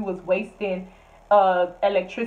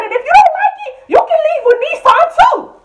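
much higher, louder shout; shouted comeback; 11 dB louder than usual. Retold or imitated. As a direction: scream the last word at her. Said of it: "I said".

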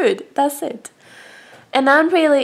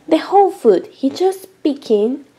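ordinary talk, nothing marked

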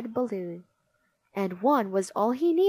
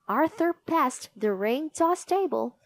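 ordinary delivery, nothing marked

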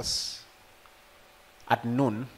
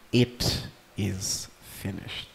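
slow last words; 5 semitones lower, 4 dB quieter, much slower than usual. Acted. Jesus' last words, slowed.

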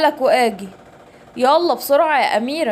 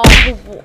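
loud punch noise; 4 semitones lower, 9 dB louder, slower than usual. Acted. Punch sound in a fight.

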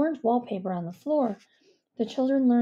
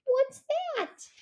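high badger voice. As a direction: voice pitched up high and slow, a worried little badger. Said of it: "asked Stuart the Badger".

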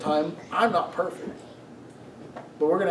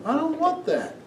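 put-on whiny child; 11 semitones higher than usual. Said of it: "Father's like".

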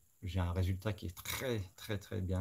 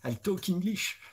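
high, jokey broken English; comic French-English accent; 10 semitones higher, 3 dB louder, slower than usual. Pretend. Painter jokes to the viewers in a comic English accent. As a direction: show off your bad English on purpose. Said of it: "t'as tous les Français qui vont se marrer".

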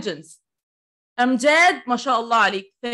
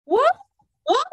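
1 surprised what; 13 semitones higher, 3 dB louder than usual. Real, not acted.

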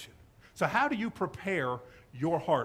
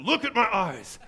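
louder, laughing plea; laughing insistence; 3 semitones higher, 6 dB louder, slower than usual. Retold or imitated.

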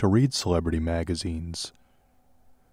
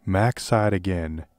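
usual speaking voice

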